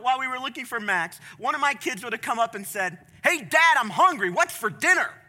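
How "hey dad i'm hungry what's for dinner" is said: put-on higher, louder demanding child; whiny kid. Child asks father about dinner, whiny kid voice. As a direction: play a kid pestering dad.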